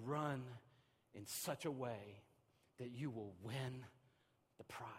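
"Run in such a way that you will win the prize" spoken softly and slowly with pauses between phrases; quiet quoting. Shown as a demonstration. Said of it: "He said".

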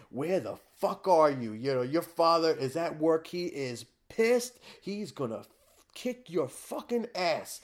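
mock Italian mom yelling at her son. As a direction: scold like an Italian mom on the phone. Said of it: "my Italian mom".

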